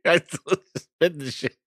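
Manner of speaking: talking through real laughter; helpless laugh breaking into the words; laughing hard. Real, not acted. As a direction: crack up while trying to speak. Laughing after spitting out drink.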